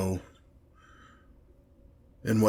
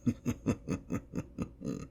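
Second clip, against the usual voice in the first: quiet, drawn-out evil snicker; soft villain laugh relishing cruelty; sinister pulsed chuckle under the breath; wicked chuckle; 2 semitones lower, 13 dB quieter than usual. Acted. Demon relishes cruel punishments.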